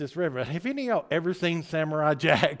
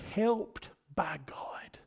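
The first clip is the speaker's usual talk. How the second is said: whispered; 2 semitones lower, 8 dB quieter, slower than usual. Real, not acted. Explaining name meaning softly.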